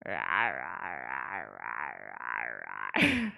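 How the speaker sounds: low growly "rah" noises repeated; goofy frustrated growling. Retold or imitated. She re-enacts her noises for the stream chat: low mock growling. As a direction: grumble like a frustrated dork. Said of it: "I make a lot of noises around here".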